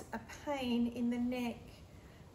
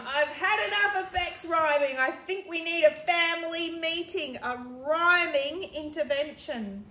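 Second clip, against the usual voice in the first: annoyed; 6 semitones higher, 8 dB louder than usual. Acted. Annoyed brother complains to mum.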